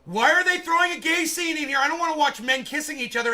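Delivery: loud, high, indignant yelling; outraged shouting. Retold or imitated. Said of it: "I was like".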